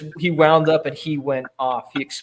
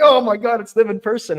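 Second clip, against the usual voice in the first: starstruck excitement; 7 semitones higher, 4 dB louder than usual. Retold or imitated. Starstruck fan meeting idols.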